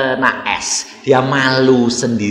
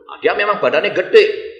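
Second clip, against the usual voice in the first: usual speaking voice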